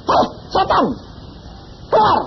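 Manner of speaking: high, sharp command at a spirit; rebuking the demon. Retold or imitated. Preacher rebuking a demon, sharp high command.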